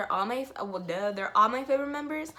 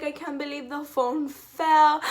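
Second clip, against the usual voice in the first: higher, louder, disbelieving; flustered wail; 5 semitones higher, 7 dB louder than usual. Retold or imitated. Fan watches phone fall mid call, high flustered wail. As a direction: wail it half laughing. Phone falls during idol moment.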